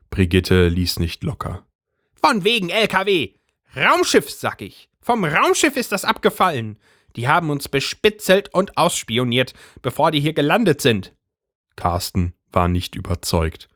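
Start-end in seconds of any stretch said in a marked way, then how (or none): 2.2-11.2 higher, excited gossiping wife; high agitated housewife voice; Brigitte's voice. A narrator's reading. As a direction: voice the worked-up neighbour wife, pitched up. Brigitte insists.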